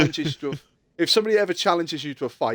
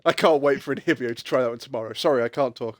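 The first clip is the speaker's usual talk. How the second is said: ordinary talk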